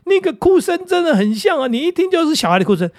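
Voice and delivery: high, fast mock victim voice; a high, quick mocking imitation of scam victims; imitating victims. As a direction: retell their claim in a high excited voice. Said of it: "被诈骗的都讲说".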